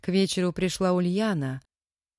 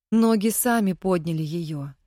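usual speaking voice

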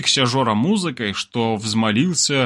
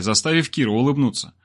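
usual speaking voice

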